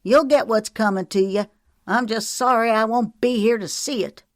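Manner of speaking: loud, high parting threat; yelling. A narrator's reading. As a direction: yell it from a distance. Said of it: "and yelled".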